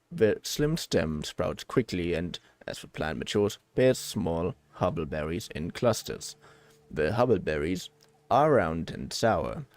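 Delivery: low, measured robot reading aloud; put-on low robot voice. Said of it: "Should I try again, robot, robot voice?".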